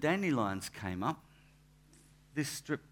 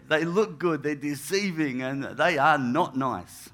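usual speaking voice

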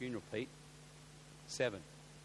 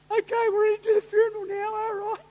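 mock tough guy; 8 dB louder than usual. Big brothel owner announcing start.

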